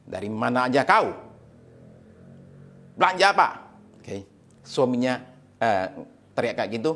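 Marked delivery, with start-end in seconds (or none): 0.1-3.5 menacing chuckle, then the question; movie thug. Acted. Husband waiting at home for his wife, menacing thug. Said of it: "senyum sangar seperti preman di film Hong Kong".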